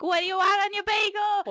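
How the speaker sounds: loud, high New York deli voice; New York accent. Retold or imitated. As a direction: bark it like a gruff New York deli guy. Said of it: "their normal New York accent".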